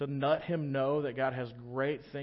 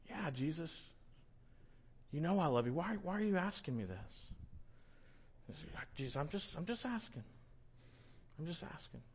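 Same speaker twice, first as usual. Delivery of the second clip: much softer, hushed exchange; quiet back-and-forth on the beach; soft reply; 2 semitones higher, 10 dB quieter than usual. Retold or imitated. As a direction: answer gently, almost under breath. Peter and Jesus quiet exchange.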